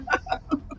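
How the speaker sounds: quick airy laugh, high and breathless; short laugh. Real, not acted. Following the host from both phones, a short airy laugh. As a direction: let a brief breathy giggle slip out.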